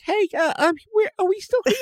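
tiny high-pitched, hesitant voice; timid forgotten underdog. Acted. Voicing forgotten Microsoft Teams timidly.

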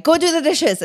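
sharp, high snapped order; snapping. Retold or imitated. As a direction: bark the order at the husband. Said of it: "I snapped at him".

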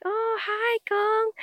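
soft, high imitation of dad; affectionate dad. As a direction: greet your child fondly in a high voice. Imitates dad greeting child.

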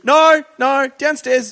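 high, loud frantic refusal; acted panic. Frightened client refusing his lawyer's lunchroom offer, loud high panicked insistence.

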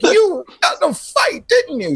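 very high, squealing mock accusation; shrill it like the suspicious owner; hammed-up boss voice; accusing boss. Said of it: "the owner was like".

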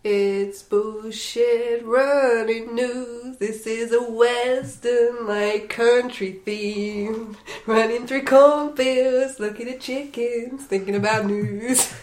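put-on slow, loud drawn-out western announcer accent; a country western news intro in a put-on accent; mystery accent. Intro for the podcast news segment, in a slow put-on western accent. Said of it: "don't know what accent that is".

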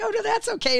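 high, bright mock "oh no, it's fine"; high-pitched protest. Host reassuring quilt helpers, high squealy protest. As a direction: squeal it out, politely brushing off help. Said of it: "You're like".

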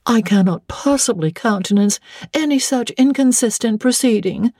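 high-pitched dowager voice; lightly voiced disapproving dowager; objecting dowager. A narrator's reading. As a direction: lodge a dowager's protest, high.

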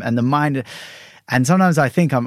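normal talking voice

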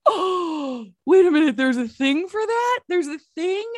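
high, astonished oh wait a minute; hammed-up surprise, voice jumping high; mock gasp of surprise. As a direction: play it like a startling discovery. Mock surprise at terror barrier.

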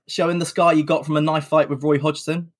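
ordinary delivery

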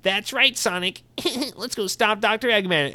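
very high, squeaky sidekick voice; Tails. Pretend. Host imagines Tails answering Sonic, high squeaky sidekick voice. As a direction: put on a high cartoon sidekick, with a giggle. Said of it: "And Tails will be like".

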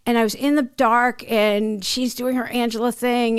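ordinary talk, nothing marked

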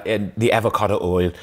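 normal talking voice

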